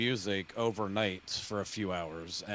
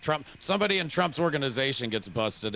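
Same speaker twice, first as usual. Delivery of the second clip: ordinary talk, nothing marked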